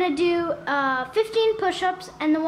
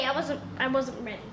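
lower, softer, sulky repeat; quiet deflated mumble; 6 semitones lower, 6 dB quieter, faster than usual. Real, not acted. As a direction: let it fall away, defeated. Loser protesting the result.

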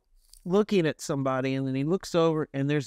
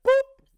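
short, louder imitated pop; popping boop; 7 dB louder than usual. Storyteller showing the chip instantly gone, sudden boop. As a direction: punch out one quick high boop.

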